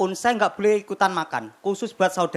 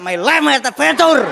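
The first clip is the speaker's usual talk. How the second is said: slow, high slogan shout; acted yell; 4 semitones higher, 4 dB louder than usual. Fallen rider shouting from the roadside, acted yell. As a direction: holler it out, stretching each word.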